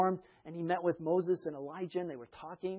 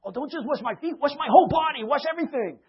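much higher and louder, overeager; eager Peter; 10 semitones higher, 11 dB louder than usual. Retold or imitated. Peter begging Jesus to wash everything, excited. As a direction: blurt it out overeagerly.

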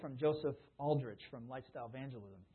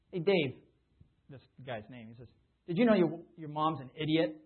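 loud, needling call-out; a retold taunt, called out loud; mock provocation; 7 dB louder than usual. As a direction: goad him with a bright jab. Coworker provokes friend on purpose.

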